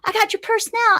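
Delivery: high-pitched retold taunt; imitated robber. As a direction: lightly act out a triumphant mugger. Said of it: "And he's like".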